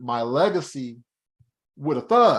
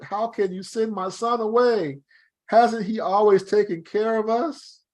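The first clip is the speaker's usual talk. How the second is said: higher pleading mother, a touch of tears; 8 semitones higher than usual. A narrator's reading. Mother Anna begging her husband to keep their son, higher pleading. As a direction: plead with him not to send your son. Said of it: "began to cry".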